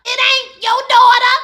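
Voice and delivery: sharp, loud and high, slowly hammering it out; angry retort. Retold or imitated. Luke shuts down Serena's claim, loud slow retort. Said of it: "And he's like".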